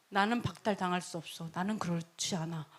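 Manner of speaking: low, soft royal certainty; quiet self-assurance. Acted. King to priests about his right, quietly self-assured. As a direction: state it under your voice, fully sure.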